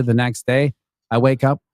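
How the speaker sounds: ordinary talk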